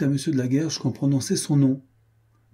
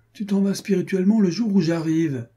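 high-pitched teasing protest; worked-up, high complaint; indignant reproach; 9 semitones higher, 4 dB louder than usual. A narrator's reading. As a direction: reproach a friend, pitch well up. Friend reproaches departing friend.

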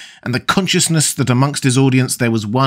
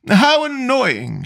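much higher, slow hammed-up lament; mock despair; 13 semitones higher, much slower than usual. A narrator's reading. The Duchess told her carriage waits, mock despair. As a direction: wail it in playful mock despair. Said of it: "in mock despair".

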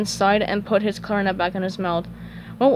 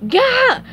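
high, loud yelp; Squidward's startled yell; 7 dB louder than usual. Acted. Cashier surprised by his co-worker, startled high yell. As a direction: yell out as if jumped. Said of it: "Squidward yelled, startled".

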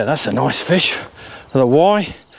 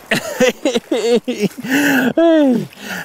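happy laugh; 7 semitones higher than usual. Real, not acted. Landing the pike.

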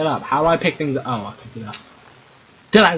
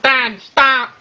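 put-on high kid voice; Timmy voice; 8 semitones higher than usual. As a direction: pitch up into a little boy. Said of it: "It's my Timmy voice".

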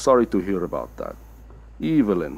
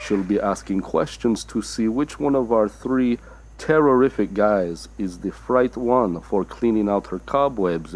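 ordinary talk, nothing marked

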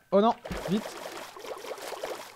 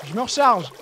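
normal talking voice

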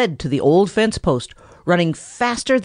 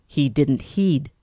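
plain reading voice

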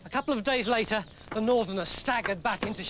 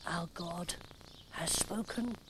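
defeated murmur; 4 semitones lower, 12 dB quieter than usual. Acted. Broken priest concedes defeat.

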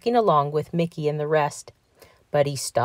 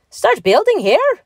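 high, loud, incredulous echo; outraged cry; 13 semitones higher, 6 dB louder than usual. A narrator's reading. Patrick echoes Mickey's building plan, outraged cry. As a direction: throw the words back in fury. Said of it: "in a purple rage".

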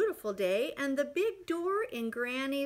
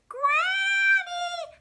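loud, high yell for granny; a boy shouting high through the screen door; calling out loud; 10 dB louder than usual. Acted. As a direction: call her from outside the door. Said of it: "And he says".

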